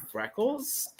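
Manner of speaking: high, soft questioning lift; dumbfounded. Retold or imitated. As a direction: echo the word in mock disbelief. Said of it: "she was so dumbfounded".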